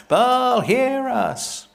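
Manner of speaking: high, loud cry of "Baal, hear us"; calling out. Acted. Priests of Baal crying out to their god, loud high call.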